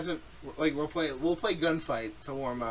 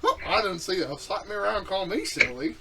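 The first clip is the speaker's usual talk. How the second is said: higher, louder southern imitation; mock southern accent; 6 semitones higher, 5 dB louder than usual. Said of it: "It's fucking funny when people".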